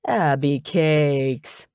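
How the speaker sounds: very slow, stretched-out coo; smarmy pet name. A narrator's reading. Ex greeting her with a pet name, slow and smarmy. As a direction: drag out the nickname smarmily.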